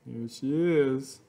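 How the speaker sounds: usual speaking voice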